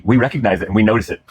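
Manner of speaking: ordinary delivery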